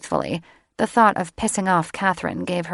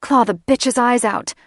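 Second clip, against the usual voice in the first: higher, louder urging; demanding; 6 semitones higher, 5 dB louder than usual. Acted. Felix urging Catherine to attack, loud demand. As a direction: egg her on, bloodthirsty. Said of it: "Felix demanded".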